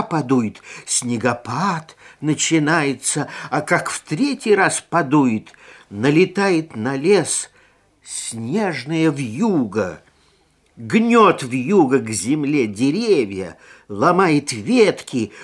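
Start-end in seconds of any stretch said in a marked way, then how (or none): none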